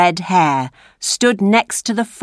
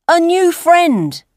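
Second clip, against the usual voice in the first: put-on cheeky boy; 10 semitones higher, slower than usual. A narrator's reading. Dodger answers.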